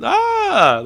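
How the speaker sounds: sudden high yelp, half-joking; a jokey flailing cry, yanked way up; playful fake yelp imitating a player throwing up his arms; mock yelp. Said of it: "he's doing".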